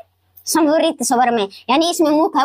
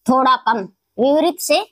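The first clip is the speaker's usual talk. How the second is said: ordinary talk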